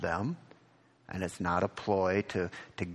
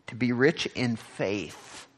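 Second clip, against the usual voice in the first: usual speaking voice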